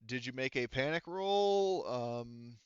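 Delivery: ordinary talk, nothing marked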